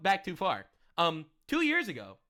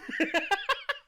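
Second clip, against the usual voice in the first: high-pitched giggling fit; real laughter; 12 semitones higher than usual. Real, not acted. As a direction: crack up, high and helpless. Cracks up at own sarcasm.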